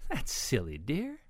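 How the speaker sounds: lightly voiced Mama pig, lifting on "dear"; amused snort. A narrator's reading. Mama pig reassures Ruby, lightly amused. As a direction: lilt up at the end, mildly amused. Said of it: "Mama Sniff chuckled".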